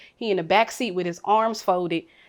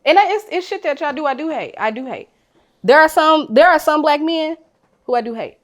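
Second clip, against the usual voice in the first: raised, intense voice, high and loud; heated admission; 8 semitones higher, 8 dB louder than usual. Real, not acted. Admitting to friends she hates some men, loud and heated. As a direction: push it out like a hard confession. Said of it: "confessing hate loudly".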